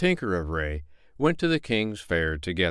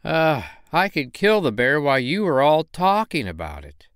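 a touch louder, scornful; impatient boast; 6 semitones higher, 4 dB louder than usual. Acted. Miller's son scoffs at the arguing crowd, impatient. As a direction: brush off the chatter. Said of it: "said the miller's son".

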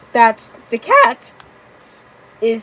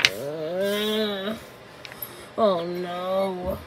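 sad lady; 4 semitones lower, 14 dB quieter, much slower than usual. A narrator's reading. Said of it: "the sound of the sad lady".

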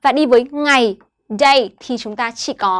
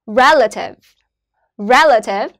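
"Relative" with RE- punched, -lative light; stress on first syllable. Shown as a demonstration. Teacher models word stress for students, RE- stressed in "Relative". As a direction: hit the start of "Relative". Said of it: "cũng rơi vào âm tiết đầu tiên".

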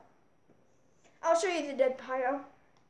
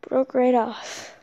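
ordinary talk, nothing marked

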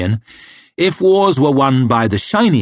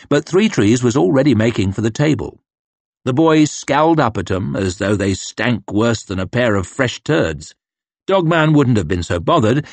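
usual speaking voice

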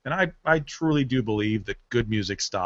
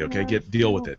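usual speaking voice

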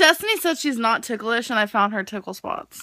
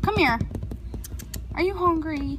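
higher, sweet pet talk; 6 semitones higher than usual. Real, not acted. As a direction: coo at the kitten. Talking to a kitten.

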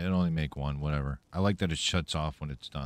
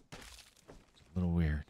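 quiet slow voice; 6 dB quieter, slower than usual. Shown as a demonstration. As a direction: murmur it slowly. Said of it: "repeated in other voice".

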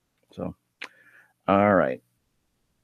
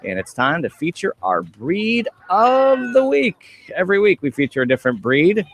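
lifted, punchy welcome-back announcement; radio host intro; 7 semitones higher, 3 dB louder than usual. Acted. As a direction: open the segment like a radio announcer. Host opens a radio segment.

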